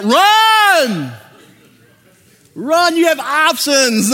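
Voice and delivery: high, loud burst of warning; urgent shout. Real, not acted. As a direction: yell it at the singles to flee. Warning singles about bad partners.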